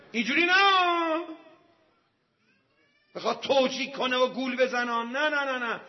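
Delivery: high, loud preacher's protest; emphatic rejection. Real, not acted. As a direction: cry out a forceful refusal. Preacher rejects the imagined version. (رد قاطع با فریاد)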